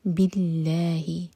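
باللہ with a thin light lam, lips spread. Shown as a demonstration. Teacher models the light lam slowly. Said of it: "وہ light پڑھا جائے گا".

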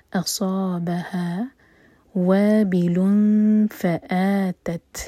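voiced, very slow tajweed recitation; slow Quran recitation. Shown as a demonstration. Teacher recites the verse to show the rules, slowly. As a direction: draw each syllable out carefully. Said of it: "صا مد طبعی".

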